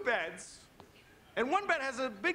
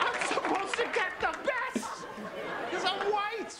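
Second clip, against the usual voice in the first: hammed-up outrage; 10 semitones higher, slower than usual. Comedian ranting at the hotel in hammed-up fury. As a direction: fake fury, laughing through it. Mock outrage rant on stage.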